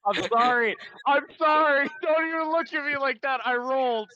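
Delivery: real laughing fit; laughing apology. Real, not acted. Player laughing at bad roll.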